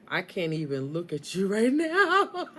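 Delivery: loud, slow, rising mock outrage; hammed-up indignation breaking into a laugh; mock pissed-off. Reader voicing the angry partner to Scorpio, mock pissed-off. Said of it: "He is pissed off at you".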